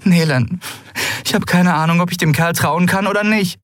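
high-pitched, pleading character voice; upset Endriel figure. A narrator's reading. Pilot admitting doubt about trusting him, pleading.

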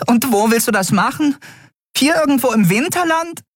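very high-pitched, fretful little voice; small creature in a high panic; agitated fairy. Acted. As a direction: fret in a tiny squeaky voice. Fairy challenges the plan.

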